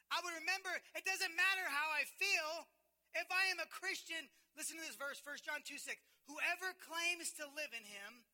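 loud, high, driving sermon voice; full-force preaching. Acted. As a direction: preach it out to the congregation. Preaching the promises of God.

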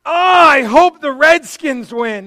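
hammed-up high, loud, slow cry of pain; mock pained groan. Shown as a demonstration. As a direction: ham up the agony over the Redskins. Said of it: "Like, that kind of, like, pain".

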